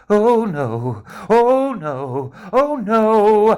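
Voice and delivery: a bear flapping about in rising panic. Acted. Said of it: "in a flat panic".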